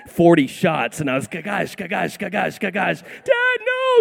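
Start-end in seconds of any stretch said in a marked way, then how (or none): 1.3-3.0 rapid-fire Nerf gun sound effect; imitated gun noise. Dad re-enacting his minigun Nerf attack, imitated gun noise. 3.0-4.0 high-pitched wailing child imitation; mock crying. Son begging dad to stop shooting, mock crying. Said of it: "He's crying".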